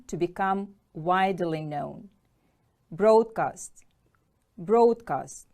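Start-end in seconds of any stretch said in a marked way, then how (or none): 2.9-3.8 "Broadcast" punched on BROAD; first-syllable stress. Shown as a demonstration. Teacher models the word for pupils, "Broadcast" stressed firmly on BROAD. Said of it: "Stress is on the first syllable". 4.6-5.5 "Broadcast" punched on BROAD; first-syllable stress. Shown as a demonstration. Teacher models the word for pupils, "Broadcast" stressed firmly on BROAD. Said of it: "Stress is on the first syllable".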